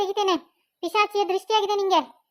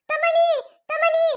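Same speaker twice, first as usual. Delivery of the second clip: frantic calling out; 9 semitones higher, 4 dB louder, slower than usual. Acted. Mother calls for missing son.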